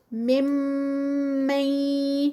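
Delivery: ممن with the doubled میم hummed very long through the nose; held ghunna. Shown as a demonstration. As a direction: hold the م of ممن as a long nasal hum. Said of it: "مم پر غنہ". (ممن میں میم مشدد پر لمبا غنہ)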